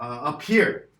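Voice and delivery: ordinary delivery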